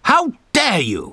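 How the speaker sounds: a hammed-up furious villain queen; mock scream. A narrator's reading. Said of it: "screamed the Litter Queen".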